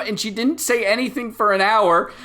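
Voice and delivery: put-on high, loud, upset boy's voice; sobbing kid pleading about his mom; crying child.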